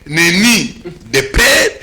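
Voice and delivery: usual speaking voice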